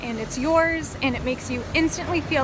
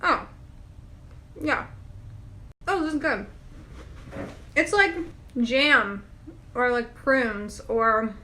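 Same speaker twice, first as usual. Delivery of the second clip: chewing while talking; talking through a mouthful; mouth full. Real, not acted. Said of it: "I'm talking with my mouth full".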